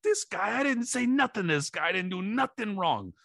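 very high, squeaky aggrieved whine; mock whining trainer. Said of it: "he's going like".

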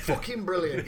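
ordinary talk

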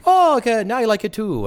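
high, loud mock Irish accent; a hammed-up Irish lilt. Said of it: "normally he would speak like an Irish".

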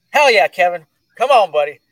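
loud, high hype shout; excited cheer. Real, not acted. Cheering on Kevin as he joins the call, loud and excited. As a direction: fire up your buddy with a whoop.

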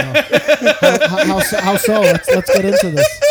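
loud, high laughing prodding. Real, not acted. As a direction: crack up while pushing him to spill. Teasing a bombshell, laughing.

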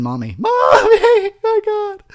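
hammed-up crying shout for mommy; mock crying. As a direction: wail like a scared kid, high and loud. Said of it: "I'd run away crying".

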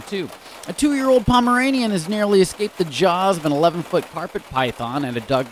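ordinary delivery

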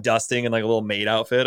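ordinary delivery

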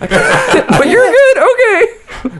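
slow, high, airless mock voice; breathless boss. Spent boss checking on a worker, hammed-up breathless impression. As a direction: let the breath give out as you speak. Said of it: "Losing breath with every word".

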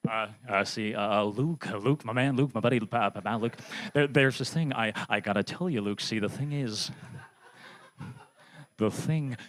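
low, stammering Goldblum voice; Jeff Goldblum impression. Comic as Jeff Goldblum talking to Luke, low stammer. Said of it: "pretending like I'm Jeff Goldblum".

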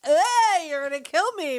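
high, loud yelp easing off; mock alarmed protest. Wife to angry husband dragging her by the ear, mock protest. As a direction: imitate a hammed-up cry of protest.

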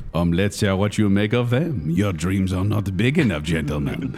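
low, lazy cartoon French accent; generic French accent. Comic doing a French cartoon accent for guessers. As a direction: purr it like a French skunk charmer. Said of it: "Generic French. / Pepe Le Pew. That is correct".

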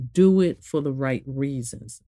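normal talking voice

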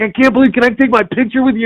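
high, starstruck gushing; freaking out. Retold or imitated. Actor asking his cosplayer for a picture, freaking out. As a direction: beg like an overexcited fan. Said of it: "totally freaking out about this guy".

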